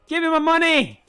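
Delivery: loud, high yell for money; shouted demand. Acted. A thug yelling at the victim, loud shout. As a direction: bellow it out.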